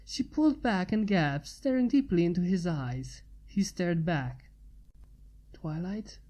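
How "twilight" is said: hushed, questioning call of her name; said softly. Acted. Man softly calling to Twilight. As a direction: barely voice it, a soft query. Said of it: "he said softly".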